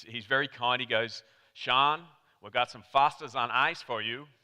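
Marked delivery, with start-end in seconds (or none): 1.5-4.3 hammed-up bad American accent; Australian doing a clumsy American impression; terrible American accent. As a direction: put on a badly faked American accent. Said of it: "that's my terrible American accent".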